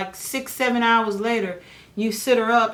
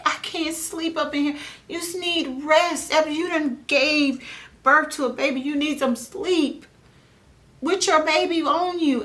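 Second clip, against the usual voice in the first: exasperated protest; 7 semitones higher than usual. Retold or imitated. Her retold protest to staff.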